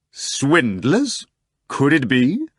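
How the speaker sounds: theatrically anxious question. Acted. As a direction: feign worry about swindlers. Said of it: "asked the guest anxiously".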